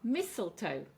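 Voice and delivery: "Mistletoe" with a strong Mis, falling; first-syllable stress. Shown as a demonstration. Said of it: "stress on the first syllable".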